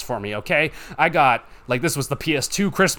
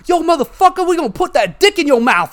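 much higher, louder mock thug voice; gang members taunting; 7 dB louder than usual. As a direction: ham up a menacing street gang taunt. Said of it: "the gang members, like, came up to me".

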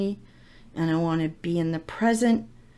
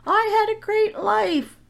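much higher, slow called-out yell; son yelling; 4 dB louder, slower than usual. Retold or imitated. Son yells to mom from the back door, high slow call. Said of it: "And he yells out the back door".

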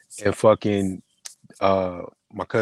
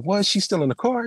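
higher, incredulous question; mock disbelief at a friend; 11 semitones higher than usual. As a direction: act shocked she's still waiting. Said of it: "and he's like".

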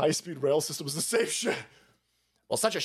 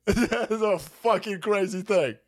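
ordinary delivery

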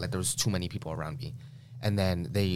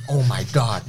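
louder, dismayed gasp of a father; dad's shocked reaction; 4 semitones higher, 9 dB louder than usual. Retold or imitated. Father staring at his son's eye, loud dismay. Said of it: "And he's like".

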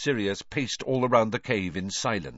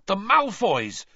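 high, loud, drawn-out exclamation; sudden loud outburst; 13 semitones higher, 6 dB louder, slower than usual. A narrator's reading. Ron seizing on a suspect, bursting out loudly. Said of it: "so loudly that his voice echoed all around the cave".

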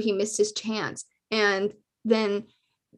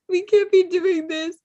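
higher, louder, through laughter; laughing higher; 7 semitones higher, 4 dB louder than usual. Real, not acted. Host scolding herself while laughing, higher and louder. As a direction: repeat it cracking up.

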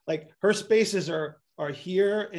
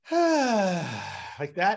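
sighing out the air like the patient; demo sigh; 2 semitones higher, 3 dB louder than usual. Shown as a demonstration. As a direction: impersonate the patient's relieving sigh. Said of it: "and eventually they just go".

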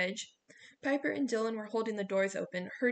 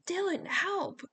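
much higher, louder cry for help; desperate shout; 10 semitones higher, 5 dB louder, slower than usual. A narrator's reading. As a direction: scream for help over the wind. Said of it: "Piper shouted".

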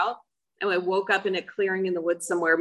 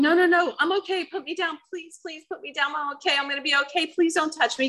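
high, hurried frightened pleading; retold panic; 9 semitones higher than usual. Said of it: "panicking, going".

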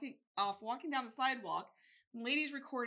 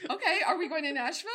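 fast, loud laughing shout; eager shout; 6 semitones higher, 9 dB louder, faster than usual. Pretend. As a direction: pump everyone up. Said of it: "that would be Alexis".

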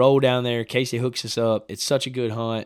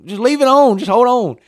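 much higher and louder, pleading to hold fire; urgent shout; 11 semitones higher, 11 dB louder than usual. Retold or imitated. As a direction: yell at him to wait, voice jumping up. Urging dad to hold fire.

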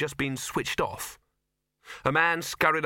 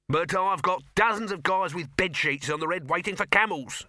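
raised, higher-pitched exasperated protest; shouting; 7 semitones higher, 4 dB louder than usual. A narrator's reading. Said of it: "shouted Dibbler".

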